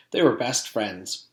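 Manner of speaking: plain reading voice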